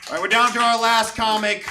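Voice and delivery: shouted club introduction, rising hype; emcee hype. Real, not acted. Host bringing up [name], shouted emcee intro. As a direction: pump the crowd up for the next act.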